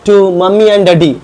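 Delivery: slow, loud English read for the class; slow classroom reading. Shown as a demonstration. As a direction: read each word out slowly and clearly. Teacher reads English text aloud.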